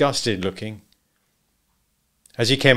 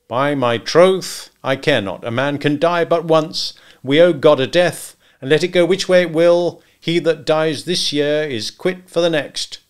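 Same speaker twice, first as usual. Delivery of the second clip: loud, higher, measured recital; quotation recited; 6 semitones higher, 6 dB louder than usual. Acted. Hunter recites his Shakespeare motto, declaiming. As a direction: declaim it with conviction.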